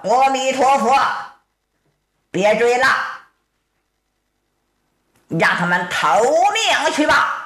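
洪亮地高声喊阿弥陀佛; 大和尚亮开嗓子喝止家人; 和尚喊话. Acted. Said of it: "一声喊道". (和尚洪亮高喊)

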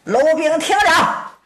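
a commanding shout into the camp; called out loud. Acted. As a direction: raise the voice and call toward the gate. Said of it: "冲里边高声喊道".

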